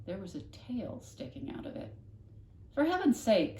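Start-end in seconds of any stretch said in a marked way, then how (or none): 2.7-3.6 higher, louder fussy neighbour; fussy older woman exclaiming; exasperated exclaim. Acted. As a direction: throw up your hands, for heaven's sake. Mrs Tickham exclaims at vacuum.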